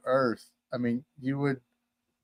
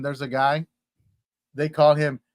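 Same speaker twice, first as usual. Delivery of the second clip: ordinary delivery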